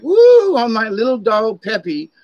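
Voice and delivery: high, loud gushing over the little dog; imitating a lady fussing over her Chihuahua; mock doting lady. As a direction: squeal like a doting dog owner.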